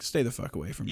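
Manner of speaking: low muttered warning. Retold or imitated. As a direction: mutter it under your breath. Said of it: "I'm like".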